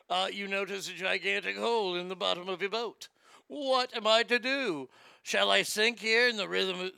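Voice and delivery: plummy mock-British pitchman slipping into Australian; hammed-up British-Australian accent. [name] setting up a dinghy mishap, hammed-up British accent. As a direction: put on a bad British infomercial accent. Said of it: "It's all going Australia for some reason".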